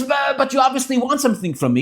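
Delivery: high, loud stammering protest; worked up. Retold or imitated. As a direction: act out a flustered man arguing back. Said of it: "the guy is getting worked up".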